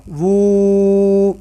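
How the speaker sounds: وو held as woooo; long woo. Shown as a demonstration. Teacher models the madd vowel. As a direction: stretch وو evenly. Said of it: "پیش کے آگے واو مدہ".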